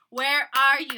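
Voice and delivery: loud, punched question; retold impatient near-shout; imitating impatient demand. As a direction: demand it like a caller kept waiting. Retold impatient date calling.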